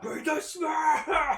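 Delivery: very slow, high battle cry; drawn-out attack call. Retold or imitated. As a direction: stretch the attack name out.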